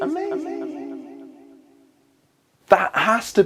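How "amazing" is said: awed. Acted. Awed slow repeat.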